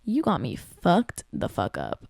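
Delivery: normal talking voice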